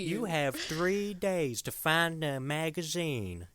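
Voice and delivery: villain giving Mort three days to find the magazine; Shooter's accent. Retold or imitated. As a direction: lay down the ultimatum in the villain's accent. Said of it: "I love his accent so much".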